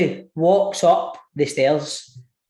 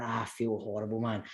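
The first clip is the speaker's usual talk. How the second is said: much softer, lower pained moan; groaning misery; 8 semitones lower, 15 dB quieter than usual. Retold or imitated. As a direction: moan it, sick and sorry. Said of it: "I'm going".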